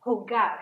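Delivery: jugar with an English h sound, hoo-GAR; Spanish J sound. Shown as a demonstration. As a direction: start jugar with a breathy h. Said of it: "is to play".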